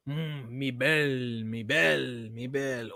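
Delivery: slow, crooning mock Italian; savoring it like a painter admiring his work; Da Vinci murmuring mock Italian to the Mona Lisa; fake Italian. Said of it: "my fake Italian Da Vinci".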